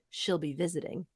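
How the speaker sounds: parenthetical aside. Retold or imitated. Reading a tweet's parentheses aloud, soft parenthetical aside. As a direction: drop it down like bracketed text. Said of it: "And then parentheses".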